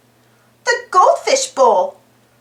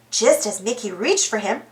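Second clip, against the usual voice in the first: usual speaking voice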